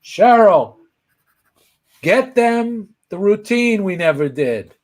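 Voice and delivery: high holler to someone across the room; calling out. Retold or imitated. Comedian sending his assistant for routines, calling out. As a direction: imitate a boss calling out an order.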